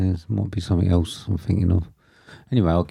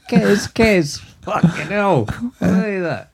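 shocked shout; 11 semitones higher, slower than usual. Real, not acted. Shocked by something odd.

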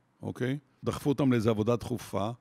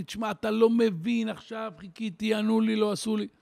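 stressed complainer; 8 semitones higher than usual. Retold or imitated. Stressed man venting to a helper, high pressured complaint. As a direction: sound cornered and flustered. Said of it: "אתה בלחץ".

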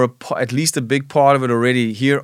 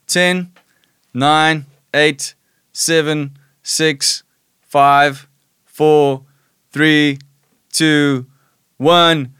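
countdown; 3 semitones higher, 5 dB louder, much slower than usual. Real, not acted. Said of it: "I want you to count down with me".

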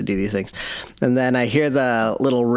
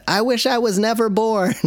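put-on whining child; 10 semitones higher than usual. Child's tantrum voiced by dad.

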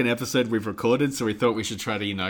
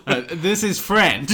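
put-on Eastern European accent; 7 semitones higher, 6 dB louder, slower than usual. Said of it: "I went for a vaguely Eastern European accent".

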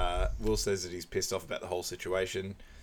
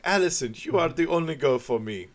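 high, louder mock lovestruck voice; mock romantic line; 7 semitones higher, 4 dB louder than usual. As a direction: ham up a lovesick declaration. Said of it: "He's like".

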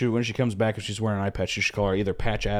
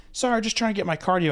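mock out of breath; 9 semitones higher than usual. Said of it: "She's cycling up like this".

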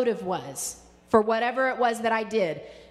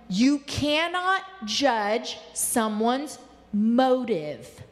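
slow emphatic; much slower than usual. Shown as a demonstration. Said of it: "I'm gonna say that again".

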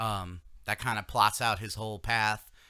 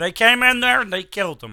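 high, loud put-on voice; mock voice; 10 semitones higher, 12 dB louder than usual. Host continuing the bit in a loud high put-on voice. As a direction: keep the silly voice going. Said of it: "we're doing voices".

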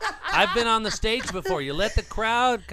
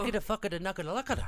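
quick, softer gibberish patter; made-up language; 6 dB quieter, much faster than usual. Pretend. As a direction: rattle it off like a fluent nonsense tongue. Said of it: "we've got our own language".

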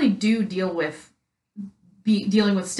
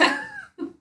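a squeaky laugh, way up high; high squeal of laughter; 7 dB quieter than usual. Real, not acted. Laugh after the joke.

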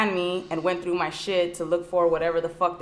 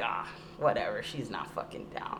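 low, much softer, whispered shrug-off; muttered dismissal; 8 semitones lower, 8 dB quieter than usual. Retold or imitated. Imitating the cops muttering as they give up, low whisper. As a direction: mutter it lower, brushing her off.

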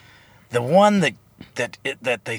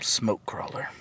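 hushed, unvoiced interjection; whispered aside; 5 semitones lower, 9 dB quieter than usual. Real, not acted. Host heckling the plot summary, whispered aside. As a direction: slip it in under the breath in a whisper.

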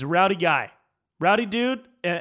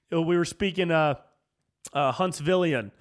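ordinary talk, nothing marked

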